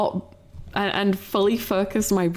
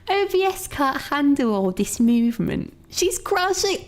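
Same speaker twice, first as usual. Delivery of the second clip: character voice; 5 semitones higher than usual. Pretend. Voicing the model complaining.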